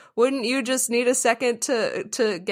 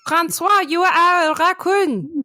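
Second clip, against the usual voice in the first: high, loud French skunk parent; French accent; 11 semitones higher, 6 dB louder than usual. Acted. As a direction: insist loudly in a mock French accent. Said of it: "the skunk had a French accent".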